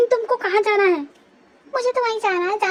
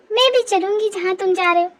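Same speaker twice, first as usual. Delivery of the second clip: ordinary talk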